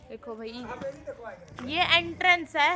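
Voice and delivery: ordinary talk, nothing marked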